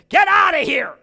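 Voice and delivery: high, loud shout; furious shout at the little dog; yelled command. Retold or imitated. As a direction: yell it to send the dog away. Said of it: "stamped her foot down and yelled".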